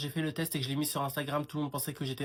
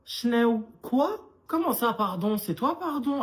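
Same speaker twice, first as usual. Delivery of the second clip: high, louder outraged questions; outraged disbelief; 8 semitones higher, 5 dB louder, slower than usual. Acted. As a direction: throw the pardon back at her. Outraged reply to mother's pardon.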